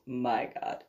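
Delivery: breathed, hushed oh my God; whispered dismay. Retold or imitated. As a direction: whisper it in disbelief. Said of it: "He's like".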